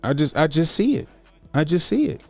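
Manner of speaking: ordinary talk, nothing marked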